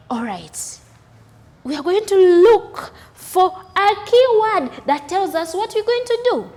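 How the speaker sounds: ordinary talk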